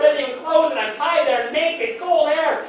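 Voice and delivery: ordinary talk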